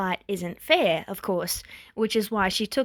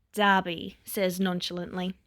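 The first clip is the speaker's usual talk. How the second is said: ordinary delivery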